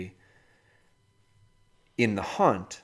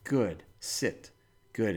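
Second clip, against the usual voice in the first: calm dog commands; 6 dB quieter, much slower than usual. Shown as a demonstration. Trainer quietly telling his dog to sit, soft and calm. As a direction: settle the dog with quiet words. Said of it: "I just call 'em back".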